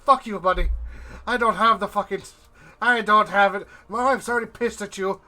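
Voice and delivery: angry tirade. Acted. Dwarf out of money telling off his buddy, ranting. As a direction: rant on, loud and high. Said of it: "he's just going off this tirade like".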